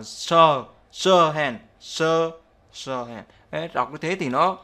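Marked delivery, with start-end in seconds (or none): 0.0-3.2 "sir hand" as emphatic sho-hand. Shown as a demonstration. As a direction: punch the SH in "sir", then glide into hand. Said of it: "Thì đọc là". (nhấn âm sh trong "sir hand")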